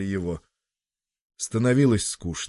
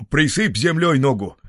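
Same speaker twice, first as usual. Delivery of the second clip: громкий совет, повыше; выкрикни совет над раненым мальчиком; совет из толпы; 6 semitones higher, 6 dB louder than usual. Acted. Советы сердобольных людей. (громко советует из толпы)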